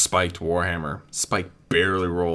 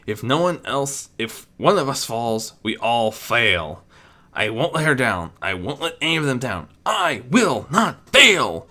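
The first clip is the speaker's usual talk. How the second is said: Spike's rising resolve; 4 semitones higher than usual. Acted. Spike vowing not to fail his friends, building. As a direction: grow stronger with each line.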